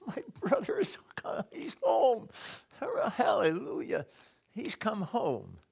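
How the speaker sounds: high, tearful-sounding cry of joy; acted jubilant welcome, drawn out at the end; overjoyed brother. Acting the son's ideal joyful reply.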